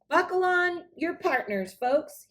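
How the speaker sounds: high, loud square dance call; cowboy calling out. Acted. Joe Prince calls the dancers to the floor, loud cowboy call. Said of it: "he said".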